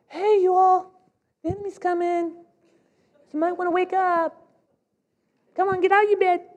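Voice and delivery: a sentry rousing the camp, keyed up but held back; excited sentry warning. Acted. Sentry calling to sleeping soldiers, high excited warning. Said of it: "I'm probably gonna come out and say".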